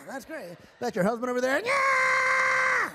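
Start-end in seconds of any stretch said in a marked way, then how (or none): 1.6-2.9 very high, loud squealing yell; a high, loud dummy cheer; ventriloquist dummy voice. Acted. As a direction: shriek it like a squeaky puppet. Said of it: "no, I do the voice".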